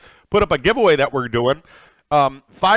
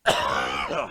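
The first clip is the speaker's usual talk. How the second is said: choking cough; 9 dB quieter than usual. Real, not acted. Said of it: "wrong pipe".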